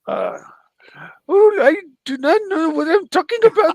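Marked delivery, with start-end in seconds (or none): 1.3-3.7 very high whining screech; Marcelo. Acted. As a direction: whine it in a screechy, hammed-up voice. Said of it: "please attempt a Marcelo impression".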